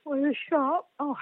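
words stretched through a yawn. Real, not acted. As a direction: talk mid-yawn, sleepy. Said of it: "and I'm yawning".

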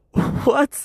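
very high-pitched, incredulous question; shocked reply. Retold or imitated. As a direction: imitate a stunned friend on the phone. Acting out John's shocked reply.

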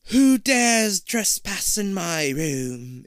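slow, loud, high creature voice; demanding monster. Acted. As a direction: boom it out like a monster queen. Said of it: "it demands".

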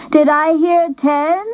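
high, lilting teacher-auctioneer call; a light warble up to the crowd; warbling auctioneer. A narrator's reading. Missus McClure warbling to the gym crowd, lightly voiced. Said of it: "warbled Missus McClure".

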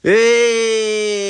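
long, high, loud cheer; drawn-out triumphant shout; celebratory cheer. Real, not acted. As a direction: cheer at full voice. Cheers the completed intro take.

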